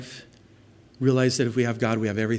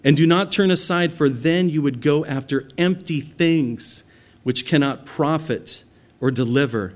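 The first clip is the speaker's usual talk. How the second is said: preaching scripture; 2 semitones higher, 4 dB louder than usual. Acted. Reading Samuel's words.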